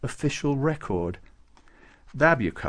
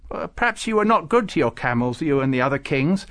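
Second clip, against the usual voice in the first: high, loud retort; indignant; 5 semitones higher, 6 dB louder than usual. A narrator's reading. Farouk accusing Babucar over his camels, indignant. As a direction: take offence on the camels' behalf. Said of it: "said Farouk indignantly".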